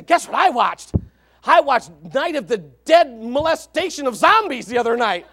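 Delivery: loud mock-excited bragging; hammed-up proud announcement; boastful kid. Church kid telling youth pastor about a movie, boasting loudly.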